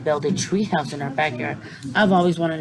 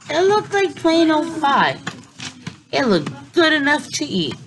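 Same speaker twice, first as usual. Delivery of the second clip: surprised; 12 semitones higher than usual. A narrator's reading. Surprised by normal pie.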